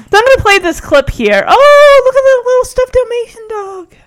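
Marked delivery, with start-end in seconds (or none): 1.5-3.9 high, loud gushing squeal; delighted cooing. Real, not acted. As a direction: coo over something adorable. Spots a cute stuffed dog.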